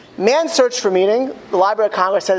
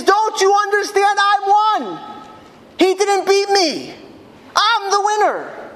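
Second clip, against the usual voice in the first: imitated high cry of defiant triumph; screaming out; 12 semitones higher than usual. Condemned prisoner telling weeping onlookers he has won, high defiant cry. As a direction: keep it pitched way up, insisting to the crowd. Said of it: "and he screams out".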